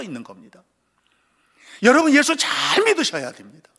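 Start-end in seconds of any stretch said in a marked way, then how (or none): 1.8-3.7 higher, louder pleading call; urgent plea. Real, not acted. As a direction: press the congregation hard. Urgent appeal to congregation. (간절하게 외치듯)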